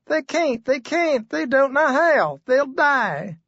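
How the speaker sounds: high, upset woman's plea; wife's voice. Acted. Wife pleading that the birds will die, high and upset.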